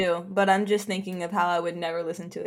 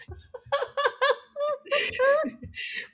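high-pitched burst of laughter; real amused laugh. Real, not acted. Laughing at own joke.

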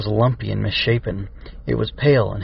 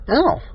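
high, short "ow"; cry of pain. A narrator's reading. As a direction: yelp in sudden pain. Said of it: "she cried".

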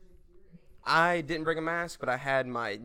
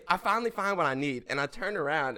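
normal talking voice

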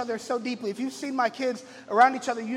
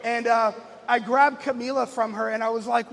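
usual speaking voice

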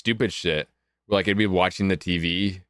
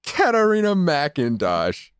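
laughing, drawn out; 7 semitones higher, slower than usual. Acted. Mocking the fake name.

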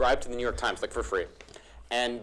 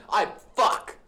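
hyped mirror pep talk; 7 semitones higher, slower than usual. Retold or imitated. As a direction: pump yourself up in the mirror. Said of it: "Ivan's like".

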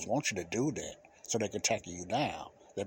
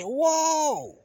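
a high, loud yelp of alarm at a charging dog; startled holler; 5 dB louder than usual. Retold or imitated. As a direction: shout it out high and loud, caught off guard. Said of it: "I probably even hollered".

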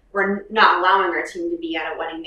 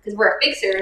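normal talking voice

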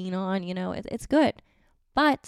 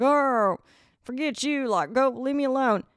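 mock-rude Southern man, pitched up; Southern man accent; 4 semitones higher than usual. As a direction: brush them off in a put-on Southern accent. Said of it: "I keep talking in Southern accents".

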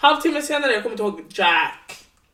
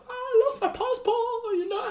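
much higher, airy put-on voice; drunk breezy; 4 dB quieter than usual. Answering the police in the car, high breezy drunk voice. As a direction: toss it off like no big deal.

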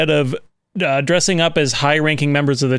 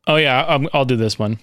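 ordinary talk, nothing marked

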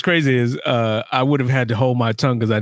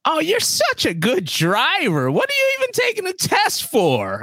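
put-on very high, slow Italian old-lady voice; exaggerated high Italian accent; old Italian woman accent. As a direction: gush like an elderly Italian examiner, pitched way up. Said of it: "she has this thick Italian ass-, accent, uh, and old".

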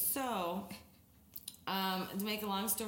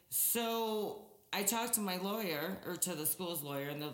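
ordinary talk, nothing marked

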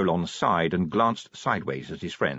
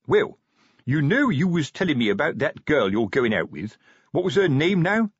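raised-pitch sergeant, feigning casualness; probing sergeant; 6 semitones higher than usual. Acted. Sergeant asking corporal about his girl, probing. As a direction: ease into an awkward question. Sergeant broaches girlfriend matter.

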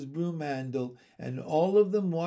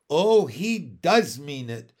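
louder dismayed wail; crying out; 8 dB louder than usual. A narrator's reading. Miss Lark realising Andrew means it, crying out. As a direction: cry out as it dawns on you. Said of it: "cried Miss Lark".

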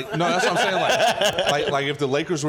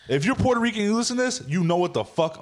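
excited; 8 semitones higher than usual. Real, not acted. Addressing Puerto Rican listeners.

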